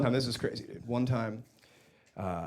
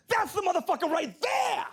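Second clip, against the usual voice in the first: excited shout. Retold or imitated. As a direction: yell it out, thrilled to spot it. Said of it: "he goes".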